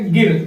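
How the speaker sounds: गिर with a quick short i; short vowel. Shown as a demonstration. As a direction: say गिर at once, without holding the i. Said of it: "तुरंत बोल दिया ना आपने". (गिर में छोटी इ, तुरंत बोलकर)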